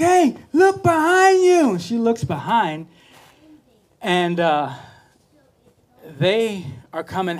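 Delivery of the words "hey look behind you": high, loud and drawn-out call. Retold or imitated. Coach shouting to the swimmer to look behind her, slow and loud. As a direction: shout the warning across the pool.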